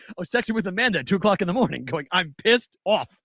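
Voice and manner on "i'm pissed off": slow, emphatic venting, rising higher; retold anger. Traveler quoting his late-night texts to Amanda, emphatically mad. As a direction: stretch out each word in retold exasperation. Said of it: "I'm still mad. I was mad last night".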